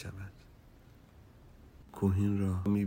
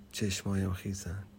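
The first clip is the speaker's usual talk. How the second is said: ordinary delivery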